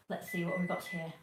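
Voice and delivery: normal talking voice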